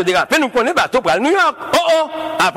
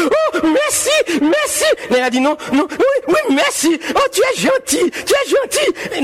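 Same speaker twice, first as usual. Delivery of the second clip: squeaky high coy lady, fluttering thanks; coy falsetto thanks; 10 semitones higher than usual.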